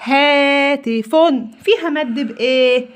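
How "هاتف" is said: هاتف said slowly with the long ا held; syllable by syllable. Shown as a demonstration. Teacher reading a practice word to children, slowly stretched. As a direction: draw هاتف out for the class to repeat. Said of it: "الكلمة الأولانية دي اسمها كلمة".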